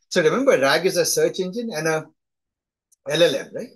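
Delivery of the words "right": ordinary talk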